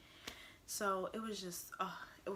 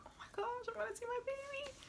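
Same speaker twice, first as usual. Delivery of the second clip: far higher, breathless joy; excited squeal; faster than usual. Retold or imitated. New mom squealing that she'll see her baby. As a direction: squeal with thrill.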